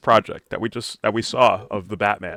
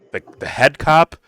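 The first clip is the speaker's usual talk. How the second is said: normal talking voice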